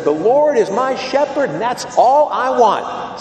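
put-on loud, high, proud child belting out the line. Little kid reciting the psalm to the priest, loud and proud. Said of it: "belts out in a full voice".

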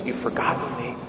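soft, low words of God; quiet reproach. Retold or imitated. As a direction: say it gently and privately to someone grieving. Same words to the grieving.

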